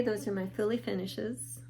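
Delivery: normal talking voice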